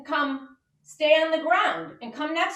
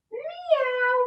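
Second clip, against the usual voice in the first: cat meow; 12 semitones higher than usual. Pretend. Teacher meowing in cat pose for kids. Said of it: "Now let's do 'em together".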